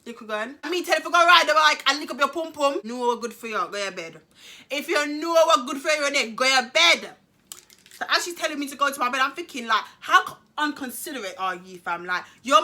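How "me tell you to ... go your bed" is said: louder, fast Jamaican patois scolding; imitating mum. Mum ordering her daughter to bed in mock Jamaican patois. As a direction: tell her off like a Caribbean mum. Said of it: "This is what she said".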